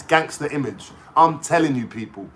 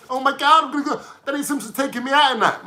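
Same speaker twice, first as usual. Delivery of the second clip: high giddy mock fan voice; mocking Ditchy; 6 semitones higher, faster than usual. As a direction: gush like a starstruck groupie, pitched up. Mocking an excited groupie.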